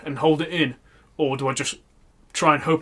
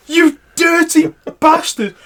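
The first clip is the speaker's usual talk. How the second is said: higher, louder, drawn-out fake outrage; slow mock accusation; 12 semitones higher, 7 dB louder, much slower than usual. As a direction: pin the blame on him loudly and slowly. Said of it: "and I went".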